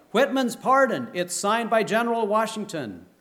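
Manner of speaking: loud, high triumphant call; shouting. Acted. Old man calls the pardon to the soldiers, loud and high.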